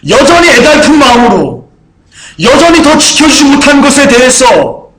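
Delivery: preaching at full force. Acted. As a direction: preach it rising to a shouted peak. Preaching climax.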